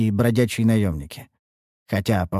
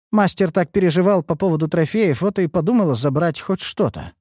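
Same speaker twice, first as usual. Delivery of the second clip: innocent; 7 semitones higher than usual. Acted. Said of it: "невинным голосом".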